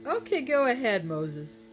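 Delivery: high mocking go-ahead; amused, dismissive Pharaoh. Pharaoh takes the threat as a joke, mocking. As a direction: wave him off, pitch starting high. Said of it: "he thought it was a joke".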